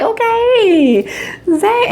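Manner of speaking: high, laughing swoon; giddy fan swoon; swooning squeal. Retold or imitated. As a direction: squeal his name. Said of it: "I was like".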